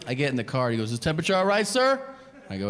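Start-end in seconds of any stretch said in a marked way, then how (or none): 0.9-2.0 professional driver. Retold or imitated. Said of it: "all of his professionalism".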